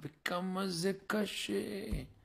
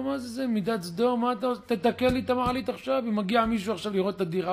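louder, higher, rushed scolding; angry demand; 5 semitones higher, 6 dB louder, faster than usual. Retold or imitated. Son scolds the avrech over the broken elevator, angry and loud. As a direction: berate him fast and loud.

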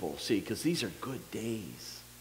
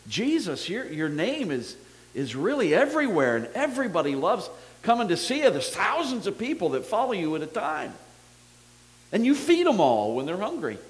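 excited Peter; 8 semitones higher, 6 dB louder than usual. Retold or imitated. Peter praising Jesus excitedly.

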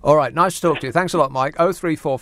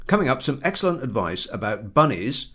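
normal talking voice

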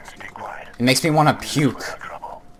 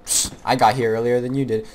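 ordinary delivery

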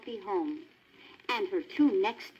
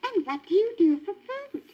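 put-on higher, young curious dog voice; Lady's questioning voice; 12 semitones higher, 4 dB louder than usual. A narrator's reading. Lady asks the Tramp where he sleeps, high and curious. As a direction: ask it innocently, pitched up as Lady. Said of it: "Lady asked him".

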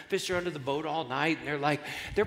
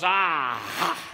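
loud, slow, triumphant gotcha; gloating atheist neighbor; 5 semitones higher, 7 dB louder, slower than usual. Acted. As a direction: crow over catching her out, stretching each word. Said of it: "The neighbor jumps out from behind the bushes and he goes".

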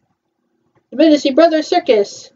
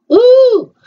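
surprised; 12 semitones higher, 5 dB louder than usual. Real, not acted. Startled reaction to film.

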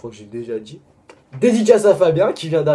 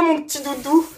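put-on a much higher, babyish coo; cutesy baby voice. Teasing a friend with a pet name, babyish coo. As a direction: coo it like talking to a toddler.

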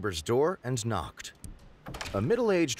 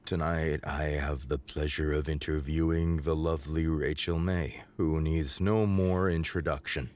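shaky voice; 9 semitones lower than usual. Acted. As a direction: read it shaky and low, unsettled. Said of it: "continued with a shaky voice".

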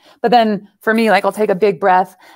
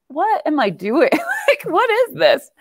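high, laughing bewilderment; baffled, laughing at yourself; laughing disbelief; 9 semitones higher, slower than usual. Retold or imitated. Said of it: "I'm like".

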